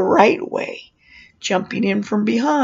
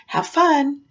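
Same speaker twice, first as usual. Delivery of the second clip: bright mock-chipper lift, higher pitched; cheery employee greeting; 6 semitones higher than usual. Ride worker greeting guests as they file through, cheery. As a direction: send guests off with a high, swooping lilt. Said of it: "Well, it'd start out".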